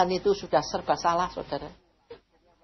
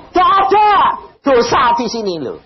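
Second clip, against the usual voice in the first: God speaking; 9 semitones higher, 7 dB louder than usual. Retold or imitated. God answers the preacher.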